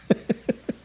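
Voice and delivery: quiet breathy chuckle; short laugh at one's own story. Real, not acted. Amused at cousin ignoring his messages. (짧고 낮게 터지는 웃음)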